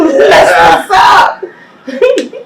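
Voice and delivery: prayer rising to a high, loud hammed-up wail; retold desperate plea, climbing in pitch and volume; mock crying prayer. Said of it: "crying and praying".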